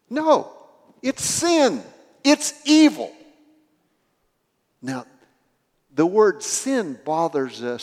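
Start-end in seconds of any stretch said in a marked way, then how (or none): 0.1-3.1 high and loud, hammering each short phrase; forceful denial. Real, not acted. Preacher correcting the congregation's excuse, forceful declaration.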